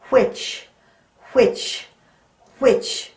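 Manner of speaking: "which" with a strong breathy wh; blowy which. Shown as a demonstration. Teacher showing the class the blowing on "which". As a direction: blow air out on each "which". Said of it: "Feel the blowing?".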